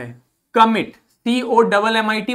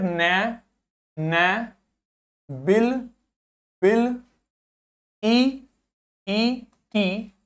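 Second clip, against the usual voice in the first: drawn-out syllables; much slower than usual. Shown as a demonstration. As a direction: drag each letter out for the class. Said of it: "तोड़ तोड़ के पढ़ते हैं". (अक्षर धीरे-धीरे खींचकर)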